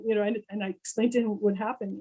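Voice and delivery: ordinary talk, nothing marked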